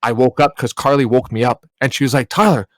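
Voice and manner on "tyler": high, loud worried questioning; alarmed waking voice. Retold or imitated. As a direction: imitate someone urgently waking a partner from a nightmare. Acting Carly waking him, alarmed.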